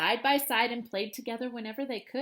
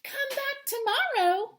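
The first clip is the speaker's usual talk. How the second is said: higher, slow, friendly teacher call; 11 semitones higher than usual. A narrator's reading. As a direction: call after the children as they leave. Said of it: "called Miss Peachum".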